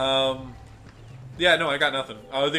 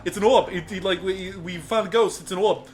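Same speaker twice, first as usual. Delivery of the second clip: raised, insistent mock Boston accent; 4 semitones higher, 4 dB louder than usual. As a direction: imitate a Bostonian ghost hunter dropping R's. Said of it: "he was like from Boston or something".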